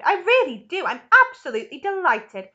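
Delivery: loud, high, gushing delight; excited cry. A narrator's reading. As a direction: heap on delighted praise with big emphasis. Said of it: "he cried".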